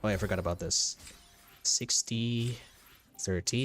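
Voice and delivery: normal talking voice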